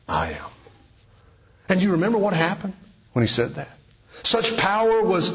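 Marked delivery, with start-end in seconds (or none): 0.1-0.5 much lower, hushed and grave; solemn I am. Acted. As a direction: say it low, like a quiet revelation. Jesus answers the arresting soldiers.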